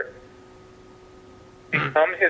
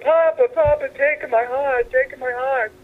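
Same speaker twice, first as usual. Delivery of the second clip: pleading crowd; 11 semitones higher, slower than usual. Retold or imitated. Crowd voiced in a joke.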